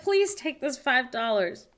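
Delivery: slow, high begging plea; pleading to leave a tip, slow and high; pleading tourist. Pretend. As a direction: beg them to take the tip. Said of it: "I'll be like".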